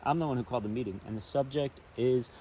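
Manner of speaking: story narration. A narrator's reading. Reading a children's book aloud by the river, storytelling narration. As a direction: read it out like a picture book for kids.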